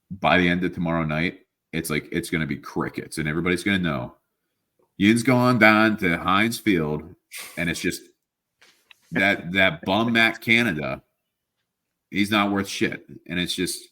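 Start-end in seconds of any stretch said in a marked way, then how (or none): 5.0-13.1 slow, loud Pittsburgh Yinzer rant; Pittsburgh Yinzer fan grousing slowly about the team; Yinzer accent. Pretend. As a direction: drag out a grumpy Pittsburgh Yinzer accent, higher and louder. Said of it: "can you please do this in a Yinzer accent".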